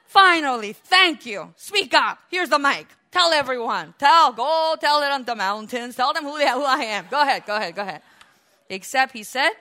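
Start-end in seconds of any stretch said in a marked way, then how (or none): 0.1-8.0 eager exclamation. Acted. Imagining Jesus handing over the mic, eager. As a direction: burst out, loudly urging them on.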